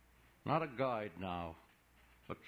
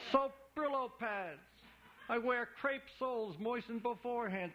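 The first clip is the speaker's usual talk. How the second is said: high, bright carnival barker; a huckster playing to the crowd, high; high showman voice; 11 semitones higher than usual. Imolati confessing the trick to George, high showman voice.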